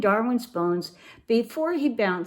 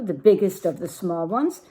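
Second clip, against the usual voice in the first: ordinary delivery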